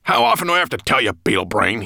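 loud, rapid scolding; angry. A narrator's reading. Dragon lashing out at his storyteller, angry and loud. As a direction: snarl at a forgetful servant. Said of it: "He struck out angrily".

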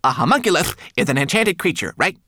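high, loud indignant retort; snapping back. A narrator's reading. Homunculus answers the brownie's accusation, snapping. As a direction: snap back as a tiny offended creature. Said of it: "Twigleg snapped back at her".

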